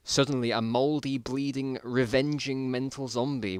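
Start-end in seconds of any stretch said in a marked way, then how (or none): none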